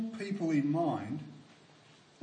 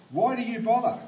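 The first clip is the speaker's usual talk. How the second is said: raised, higher voice imitating a heckler's challenge; retold yell; 6 semitones higher, 6 dB louder, faster than usual. Man yells back at preacher across the street, retold yell. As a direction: throw the question out like a mock yell. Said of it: "he yelled back at me".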